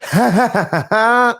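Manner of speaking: high strained evil cackle; practising evil laugh. Retold or imitated. Host practising Sasha's laugh, strained high cackle. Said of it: "it's hurting my voice just trying to do it".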